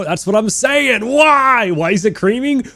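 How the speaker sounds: high, loud incredulous outburst; excited rant. Real, not acted. Streamer ranting to chat about creaming soda, high excited disbelief. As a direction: throw your hands up in disbelief.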